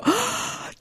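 a quick high intake of breath; sharp shocked gasp. Retold or imitated. Bride hearing unscripted vows, gasping in shock. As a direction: gasp as if caught completely off guard.

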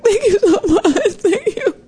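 high-pitched squeaky sobbing gratitude, hammed up; imitating grateful blubbering; mock tearful thanks. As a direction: exaggerate weeping with relief as you thank them. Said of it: "I'm kinda trivializing it now".

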